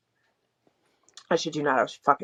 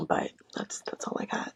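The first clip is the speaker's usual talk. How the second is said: whispered trailing off; 8 dB quieter than usual. Real, not acted. Trailing off at the end of the story.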